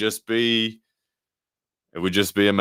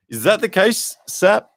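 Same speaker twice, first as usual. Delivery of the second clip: high, loud, incredulous question at Sap; can't believe what the chat says; shocked, much higher and louder; surprised question; 9 dB louder, slower than usual. Real, not acted. Disbelief at a chat claim.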